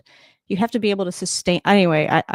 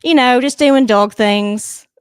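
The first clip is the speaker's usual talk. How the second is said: put-on British accent; 5 semitones higher, 9 dB louder, slower than usual. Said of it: "I'm actually trying to practice a British accent".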